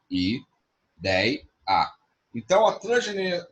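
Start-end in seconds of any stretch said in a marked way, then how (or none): 0.1-1.9 ideia broken into separate syllables I, dei, a; split into syllables. Shown as a demonstration. Teacher showing students the syllables of ideia. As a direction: say each syllable apart with pauses. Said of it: "Qual é a separação silábica dela?".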